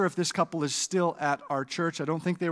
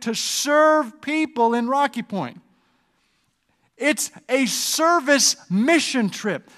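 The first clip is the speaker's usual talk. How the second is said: indignant emphasis; 6 semitones higher, 6 dB louder than usual. Real, not acted. Indignant at couple's demand.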